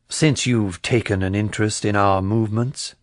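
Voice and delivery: plain reading voice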